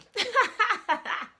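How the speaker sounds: loud, high burst of laughter; hearty laugh. Real, not acted. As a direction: burst out laughing at your own joke. Laughs at her own joke.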